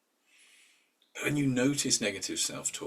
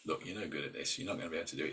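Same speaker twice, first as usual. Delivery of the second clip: softer, lower mock put-down; a quieter, lower nagging put-down; mock inner critic; 5 semitones lower, 6 dB quieter, much faster than usual. Imitating the negative voice in your head, softer and lower.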